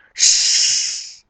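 long, loud voiceless hiss; imitated hiss of a spray can. A narrator's reading. Nozzle finally sprays at the caterpillar, a sharp hiss. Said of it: "hangzott fel a sziszegő hang".

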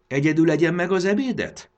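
put-on grandma calling after the boy, indignant. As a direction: call after him, offended. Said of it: "szólt utána a nagymama méltatlankodva".

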